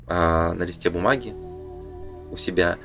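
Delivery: ordinary talk, nothing marked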